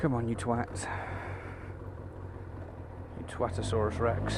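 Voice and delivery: real irritation, muttered low to himself; muttered road rage. Real, not acted. Swearing under his breath at another driver, muttered angry.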